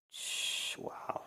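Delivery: whispered, breathless shh then wow; hushed reaction. Retold or imitated. Onlookers quietly stunned by Christians fighting, whispering. People reacting to bad behavior.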